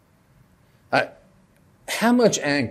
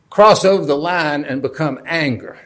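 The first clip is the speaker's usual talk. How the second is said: ordinary talk, nothing marked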